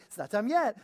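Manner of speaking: high, loud and cheery; perky. Shown as a demonstration. Telling the dog to wait, all perky. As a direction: chirp it with a smile. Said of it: "I say it all perky".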